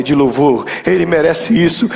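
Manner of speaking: ordinary talk, nothing marked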